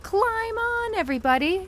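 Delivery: high excited call to all; shouting. A narrator's reading. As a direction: shout it out to the animals. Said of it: "shouted Duck".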